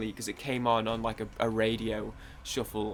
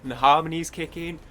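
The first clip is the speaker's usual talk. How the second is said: normal talking voice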